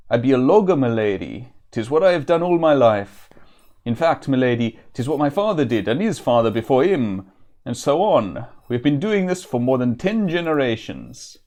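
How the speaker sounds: lilting, put-on vaguely Welsh woodsman; vaguely Welsh accent. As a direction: improvise a rough Welsh-ish country accent. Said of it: "I think it might be vaguely Welsh".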